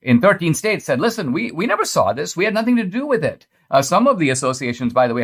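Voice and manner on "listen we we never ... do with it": high, fast, hurried mock denial. Commentator mocking the associations backing away, fast and high. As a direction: rattle it off like a guilty group covering itself.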